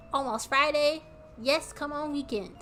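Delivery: ordinary delivery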